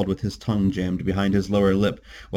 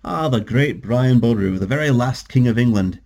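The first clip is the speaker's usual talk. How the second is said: mock-grand Celtic accent; teasing, declaimed Celtic brogue; donned Celtic accent; 3 semitones higher, 4 dB louder than usual. A narrator's reading. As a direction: put on a Celtic accent to tease. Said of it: "John donned a Celtic accent".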